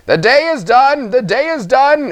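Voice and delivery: high, loud, chirpy gnome call; bright cheery call. Acted. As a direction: sing it out cheerfully to the miners. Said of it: "in a voice so bright and cheery".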